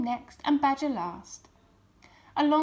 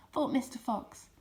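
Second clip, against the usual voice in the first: ordinary delivery, nothing marked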